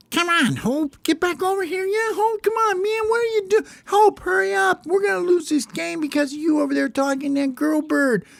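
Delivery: very high, squeaky pleading chorus; tiny birds begging Hope to come back; yelling bird teammates. Acted. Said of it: "start yelling".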